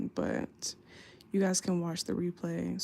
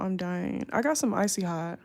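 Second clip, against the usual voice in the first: ordinary delivery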